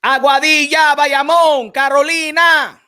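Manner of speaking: loud roll call of towns; shout-out. Acted. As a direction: call out each town proudly. Shouting out town names.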